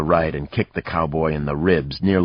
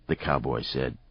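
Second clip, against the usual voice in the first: ordinary delivery, nothing marked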